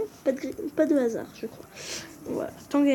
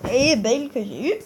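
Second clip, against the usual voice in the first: gleeful boast; 3 semitones higher, 6 dB louder than usual. Real, not acted. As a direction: crow it, stretching the last word loudly. Gleeful reveal of Bale.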